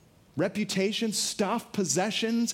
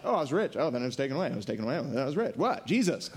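Paul's mock indifference; 7 semitones lower, much faster than usual. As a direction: shrug off riches and loss like it's nothing. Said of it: "He's like".

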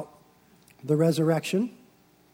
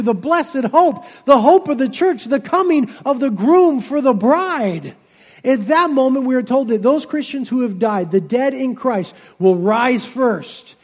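loud, raised, fervent sermon delivery; full-force preaching; 4 semitones higher, 7 dB louder than usual. Acted. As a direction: preach it out to the congregation with growing fire. Preaching climax on the rapture.

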